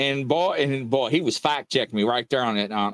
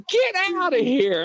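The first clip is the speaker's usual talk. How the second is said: high-pitched imitated astonishment; shocked disbelief; 13 semitones higher than usual. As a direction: act out a stunned man's disbelief, much higher. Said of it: "this guy is in shock".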